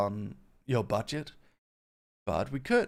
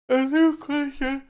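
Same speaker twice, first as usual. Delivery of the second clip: put-on high voice; 14 semitones higher, 10 dB louder, slower than usual. Retold moment from a clip.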